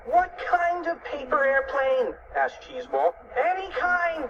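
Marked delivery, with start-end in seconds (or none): 0.1-2.2 high, slow, drawn-out cry; panicked question. A narrator's reading. Panicked question while falling in story. 3.4-4.3 high-pitched exasperated cry; mock scream. A narrator's reading. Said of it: "screamed Fluffy".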